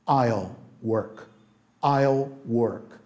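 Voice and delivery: each word placed slowly and clearly; slow and deliberate, like a teacher's first model; careful slow I'll work. Shown as a demonstration. Teacher modelling the contraction slowly for students, careful and slow. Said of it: "conforme você vai pegando a prática".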